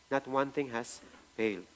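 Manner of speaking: hushed repeat, falling on "one"; soft echo. Shown as a demonstration. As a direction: let "one" fall gently as a quiet afterthought.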